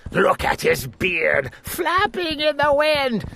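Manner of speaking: high, mocking villain voice, rising as he goes; scornful sneering lord. A narrator's reading. Lord Droon spying on the king from his window, scornful and mocking. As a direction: sneer at every foolish sight.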